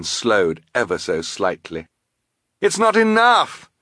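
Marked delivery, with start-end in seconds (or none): 2.6-3.7 high, loud alarm; high-pitched urgent shout; desperate cry. A narrator's reading. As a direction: cry out as the plan fails. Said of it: "Klaus cried".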